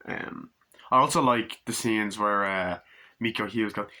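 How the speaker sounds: ordinary delivery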